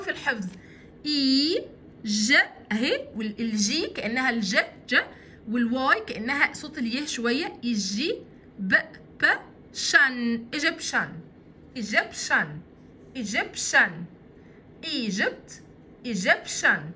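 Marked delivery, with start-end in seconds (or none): 1.1-2.3 اي ج stretched out very slowly; teacher slowly sounding out the syllables. Shown as a demonstration. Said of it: "تعالوا نشوفهم كده". 10.5-16.9 ايجيبشن repeated clearly, falling each time; teacher drilling the nationality word. Shown as a demonstration. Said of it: "تعالوا نشوفهم كده".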